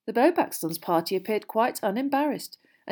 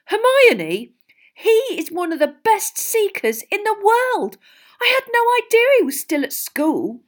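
very high, loud and excited; worked-up boy raving about his hero; incredulous fan gushing; 9 dB louder than usual. A narrator's reading. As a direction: protest in awestruck amazement. Ron gushes, outraged, about Krum.

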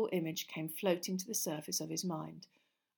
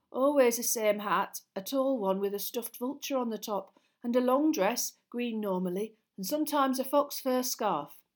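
higher pupil voice, item by item; nervous schoolboy; 5 semitones higher, 6 dB louder than usual. Acted. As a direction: list it earnestly, pitched up. Voices pupil Neville describing.

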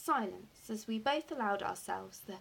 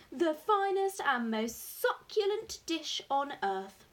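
high, relishing proclamation; mouth-watering enthusiasm; exultant cry; 7 semitones higher, 4 dB louder than usual. A narrator's reading. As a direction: declare it grandly to the moon. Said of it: "he cried out".

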